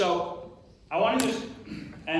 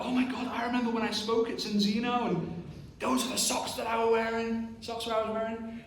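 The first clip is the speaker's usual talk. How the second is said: higher, softened tone of discovery; acted fond recognition; 6 semitones higher than usual. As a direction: play happily remembering, pitched up. Acting recognition of favorite socks.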